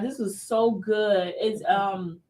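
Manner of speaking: ordinary talk, nothing marked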